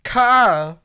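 high, loud call for Carl; Rick Grimes yelling. Retold or imitated. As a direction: yell the name like Rick Grimes. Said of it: "That's all he said in the show".

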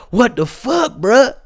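high, loud mock protest between laughs. Host replaying the rapper's shock at the water, mock protest. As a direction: act baffled, barely holding back laughter. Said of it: "He's like, he's like".

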